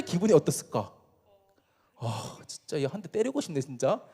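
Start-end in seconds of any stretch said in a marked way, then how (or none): 2.0-4.0 low, softened irritated grumble; mock annoyed aside under the breath; acted exasperation. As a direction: mutter it like a fed-up older brother. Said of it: "이런 생각이 들었을 거야".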